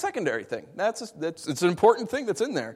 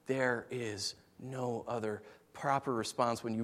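hushed preaching; 9 semitones lower, 9 dB quieter than usual. Real, not acted. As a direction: sink gently into a solemn whisper.

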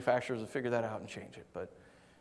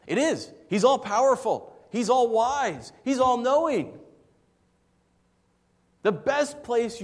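higher and louder, slow, each "all" hammered; emphatic preaching; 10 semitones higher, 7 dB louder than usual. Acted. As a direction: proclaim God's might from the pulpit. Preaching God's power at full force.

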